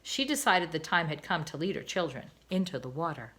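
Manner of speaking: usual speaking voice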